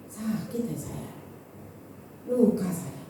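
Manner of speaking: low, soft, slow admission; subdued and humble, acted; hurt confession. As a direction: own up quietly to being wounded. Admitting her hurt to her husband.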